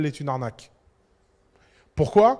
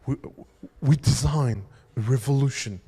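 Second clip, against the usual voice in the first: put-on British English accent; 5 semitones lower than usual. Speaker imitating Apple's British designer pitching the iPhone, British accent. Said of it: "avec son accent anglais".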